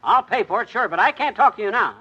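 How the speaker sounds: ordinary talk, nothing marked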